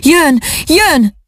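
high, ringing cry of the crowd; crowd shouting. A narrator's reading. Crowd hails the arriving emperor, high ringing cry. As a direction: call it out like a cheering throng. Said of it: "mint az áradat a kiáltás".

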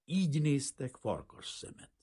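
usual speaking voice

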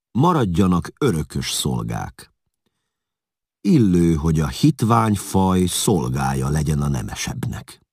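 commanding Attila. Acted. Attila decrees the servants' fate, lightly acted command.

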